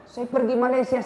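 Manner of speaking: usual speaking voice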